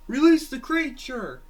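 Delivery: high, loud battle order; shouted command. Acted. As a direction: bark the order at the yellow one. Character orders creature released.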